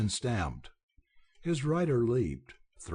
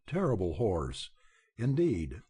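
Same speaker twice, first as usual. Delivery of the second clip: ordinary delivery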